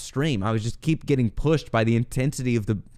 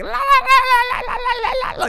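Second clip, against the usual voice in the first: very high, loud babbled la-la scream; imitated wild high-pitched screaming; mock screaming; 4 dB louder than usual. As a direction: shriek la-la-la like a friend howling at the moon. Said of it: "he's screaming".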